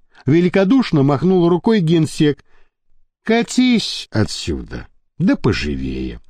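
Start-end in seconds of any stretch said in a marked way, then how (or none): 3.2-6.2 low, slow contemptuous brush-off; dismissive boss. A narrator's reading. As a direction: wave the captain away like dirt. Gensek dismisses the captain.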